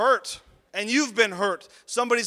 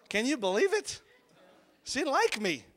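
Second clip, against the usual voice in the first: higher-pitched playful incredulity; mock disbelief; 5 semitones higher than usual. Man telling crowd his teacher disliked him, mock incredulous. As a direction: feign wounded disbelief.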